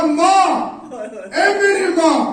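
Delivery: high, loud cry for mother; calling mother. Retold or imitated. Son crying out to his mother in the crowd, loud. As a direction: call out to her across the square.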